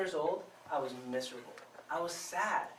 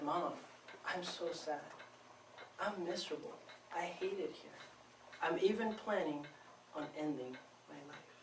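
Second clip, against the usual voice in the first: quiet confession; 2 semitones lower, 13 dB quieter than usual. Pretend. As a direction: admit the despair almost under your breath. The honest answer he never gave.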